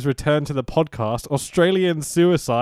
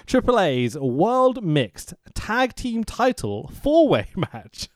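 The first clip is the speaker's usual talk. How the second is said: slow, drawn-out title call; slow announcer read; 4 semitones higher, much slower than usual. Shown as a demonstration. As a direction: announce it slowly like a ring announcer. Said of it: "that's hard to say".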